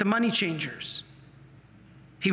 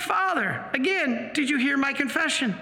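high-pitched pleading objection; retold incredulous appeal, pitched up; exasperated protest; 6 semitones higher than usual. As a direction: press the priest in disbelief. Acts out repeated protest to priest.

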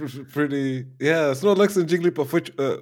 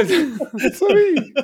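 high, loud little creature; laughing high-pitched character squeal; playful Pokémon voice; 14 semitones higher, 5 dB louder than usual. Pretend. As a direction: pipe up as an excited mock Pokémon. Playing along as a Pokémon.

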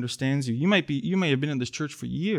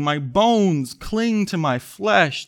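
raised, earnest reading of the psalm; scripture reading; 4 semitones higher, slower than usual. Acted. Pastor reading the psalm to the congregation, earnest. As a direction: read the lament with weight. Reads psalm verses aloud.

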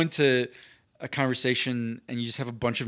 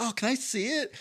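higher, lightly voiced eager girl; a hint of a coy young woman; 9 semitones higher, 5 dB quieter than usual. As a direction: imitate her sweet request. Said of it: "She's like".

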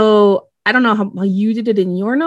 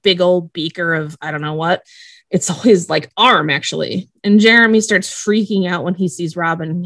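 ordinary talk

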